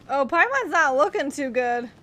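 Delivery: ordinary delivery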